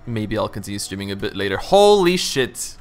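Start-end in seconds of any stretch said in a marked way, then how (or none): 1.6-2.8 drawn-out amazement. Real, not acted. Amazed at the game.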